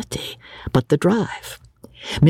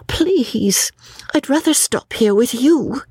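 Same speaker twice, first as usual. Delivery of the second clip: put-on high, pleading young lady; earnest high-pitched plea; 10 semitones higher than usual. A narrator's reading. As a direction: beg to stay in a raised pitch. Lucy pleads to stay.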